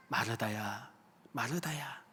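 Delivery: tender call. Acted. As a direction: call Martha's name softly, like comforting a tired friend. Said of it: "그런 우리의 이름을 부르시고 계신 거예요". (아주 부드럽고 낮게 이름을 부르는 목소리)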